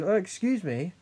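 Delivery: high-pitched mock affront; affronted retort. Support worker answering the helper's challenge, affronted. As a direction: imitate someone taking offence, pitch swooping up. Acted retort in retold quarrel.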